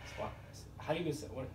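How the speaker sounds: normal talking voice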